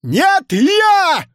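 very high, loud bawl; insistent shout; strong-voiced yell. A narrator's reading. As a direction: bawl it out to outdo the others. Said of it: "неожиданно сильным голосом проорал Иван-Царевич".

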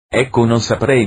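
ordinary talk

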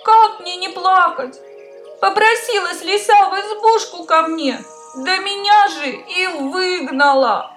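high, tearful hare voice; a little hare crying its woes. Acted. Hare complains again.

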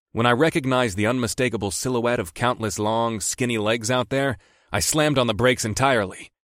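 usual speaking voice

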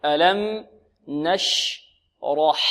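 نشرح very slow, sound by sound; thin ش, then thick dark ra in نشرح; light sheen, heavy raa. Shown as a demonstration. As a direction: spell out نشرح slowly for learners. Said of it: "الشين مرققة".

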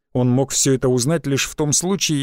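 usual speaking voice

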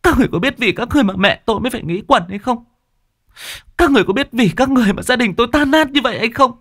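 high-pitched, tearful accusation; tearful outburst. A narrator's reading. Grieving daughter confronting the debt collectors, tearful outburst. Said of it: "mà nói trong nước mắt".

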